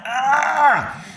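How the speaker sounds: choking shriek, far above the speaker's voice; very high strained yell. Retold or imitated. Man retelling choking in the bathroom, high shriek. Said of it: "I'm like".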